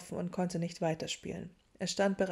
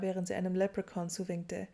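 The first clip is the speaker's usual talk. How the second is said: plain reading voice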